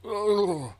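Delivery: high, loud ugh of disgust; loud disgusted groan. Retold or imitated. Reacting to a whiskey sample, disgusted loud ugh. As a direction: recoil from the taste with a loud ugh.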